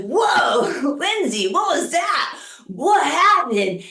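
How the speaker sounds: high, drawn-out amazed exclamation; stoned astonishment. Retold or imitated. Stoned woman to her friend after being kicked out, amazed. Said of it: "I'm still stoned".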